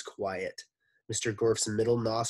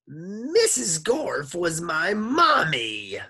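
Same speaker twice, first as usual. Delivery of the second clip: slow, higher, louder squawking; donkey with a sore throat, French accent; 9 semitones higher, 9 dB louder, slower than usual. Acted. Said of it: "Mister Gorf's voice sounded like".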